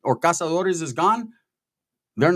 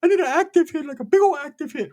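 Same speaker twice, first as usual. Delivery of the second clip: very high mock cousin voice; Ricky. Cousin saying he needs a hit too, very high put-on voice. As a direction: squeak it out in a put-on falsetto. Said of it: "Ricky's like".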